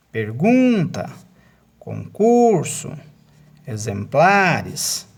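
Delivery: GUN in "pergunta", CUR in "concurso", PLA in "exemplares" drawn out; big swell on each word's strong syllable; stressed syllables exaggerated. Shown as a demonstration. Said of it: "essas sílabas tônicas".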